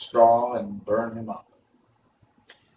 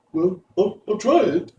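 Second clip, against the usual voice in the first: high, louder nervous stammer; hesitant lion; 8 semitones higher, 5 dB louder than usual. A narrator's reading. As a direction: stumble over the start, then commit.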